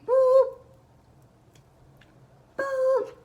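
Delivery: two very high, loud boops; piercing vocal beeps; scanner beep imitation. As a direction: mimic the scanner, spaced out.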